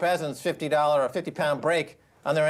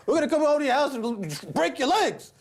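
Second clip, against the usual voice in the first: a hint of a menacing mobster, higher; mock mafia threat; 7 semitones higher than usual. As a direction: threaten like a hammed-up gangster. Bank voiced as mafia threat.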